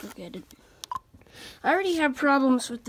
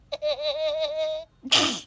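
weird laugh; 2 semitones higher than usual. Real, not acted. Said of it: "What kind of laugh is that?".

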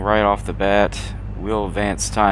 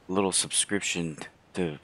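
normal talking voice

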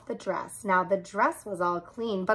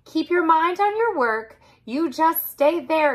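bossy cat; 8 semitones higher than usual. A narrator's reading. Cat gives orders.

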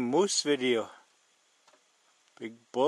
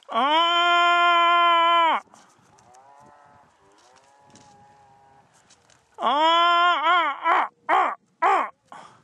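loud sustained calling ah; moose call; 13 semitones higher, 10 dB louder, much slower than usual. Real, not acted. Moose calling across the valley.

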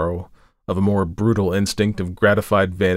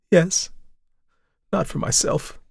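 Tessie's admission; 9 semitones higher than usual. Acted. Tessie admits her fear.

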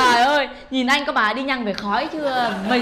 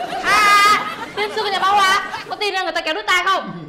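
raised, high-pitched threat; indignant scolding; 5 semitones higher, 3 dB louder than usual. Acted. Woman threatening to pull his ear, indignant scolding. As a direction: flare up and threaten him.

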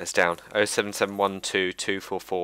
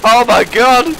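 high loud shocked cry; shocked shout; 14 semitones higher, 12 dB louder, much slower than usual. Real, not acted. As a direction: blurt out in alarm. Surprise during gunfight.

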